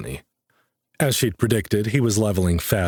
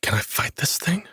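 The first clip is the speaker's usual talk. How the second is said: whisper; 10 semitones higher, 3 dB quieter than usual. A narrator's reading. Hiding from a monster.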